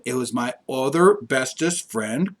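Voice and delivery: usual speaking voice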